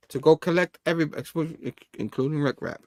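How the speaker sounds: usual speaking voice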